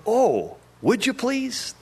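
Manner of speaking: a tiny bird's grateful plea; high little bird voice. Acted. Chickadee answers the cat.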